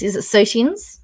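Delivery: Sotans lifted into a rising question; questioning pronunciation. Real, not acted. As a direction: check how to say Sotans, unsure. Checking the pronunciation aloud.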